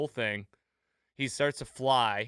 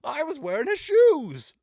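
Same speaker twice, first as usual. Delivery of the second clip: high, loud goofy imitation of a dazed android; comically funny voice; 10 dB louder than usual. Retelling a sitcom scene to podcast friends, high goofy voice. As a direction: squeak it out like a malfunctioning robot trying to be charming. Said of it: "his voice is, like, comically funny".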